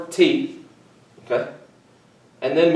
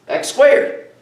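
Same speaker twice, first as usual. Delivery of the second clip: voice cracking; 10 semitones higher, 4 dB louder than usual. Real, not acted. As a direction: voice cracks on a high note. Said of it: "Sorry, my voice cracked a little bit".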